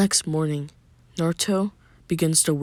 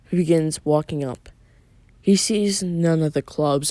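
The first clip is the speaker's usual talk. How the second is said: ordinary delivery, nothing marked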